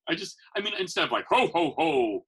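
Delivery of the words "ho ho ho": loud booming ho ho ho; a stock jolly Santa laugh, lightly done; fake Santa. Santa performer mocking the fake Santa voice he avoids, mild boom. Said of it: "I don't do a fake Santa voice".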